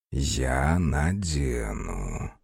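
very low, very slow husky whisper; velvety husky whisper. Acted. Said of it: "бархатистый хриплый шёпот".

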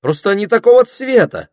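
high, loud indignation; возмущённо. A narrator's reading. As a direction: protest hotly, pitch jumping up. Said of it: "возмутилась Пенелопа". (громкое возмущение, высоким голосом)

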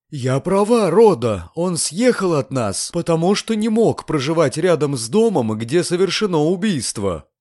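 high, fretful woman's voice; flustered landlady making her case; agitated landlady. A narrator's reading. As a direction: insist in a high, worked-up voice.

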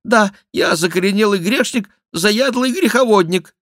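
high, loud penitent voice; put-on voice of a sham king; mock-king confession. A narrator's reading. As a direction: confess loudly, high above your own voice. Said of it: "продолжал мнимый Генрих".

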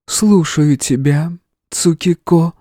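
slow, high, gentle goddess; tender female voice. A narrator's reading. Goddess answers hero in his head, tender. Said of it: "послышался ласковый женский голос".

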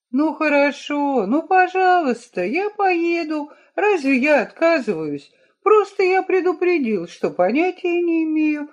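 high, whiny grown son; reluctant, put-upon whining; whiny tattling little sibling. Acted. Mitya sulks, agreeing to go.

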